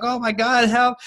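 high-pitched mock plea for help; acted cry for help. Injured speaker imagines begging a hardcore gang member, mock-panicked high cry. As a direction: act out a sudden distress call, pitched right up.

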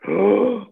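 sudden frightened gasp, pitched high; a high gasp of alarm; sharp alarmed gasp. Retold or imitated. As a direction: gasp in panic at the sight. Finds son seemingly choking.

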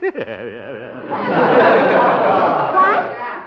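enjoy your own gag; hearty amused laugh; chuckling laugh. Acted. Laughs at saxophone joke.

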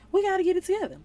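rally the room; rallying call. Real, not acted. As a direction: call them to get it together. Rallying the listeners.

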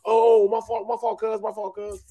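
high, fading apology; sheepish cousin. Retold or imitated. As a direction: back down, voice dropping away. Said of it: "cousins apologise".

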